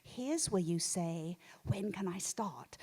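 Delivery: soft, slow inner voice, the last words whispered; low hushed prompt from a conscience; conscience whispering. Retold or imitated. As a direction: hiss the advice like a prim little conscience. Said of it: "my little conscience Agnes is like".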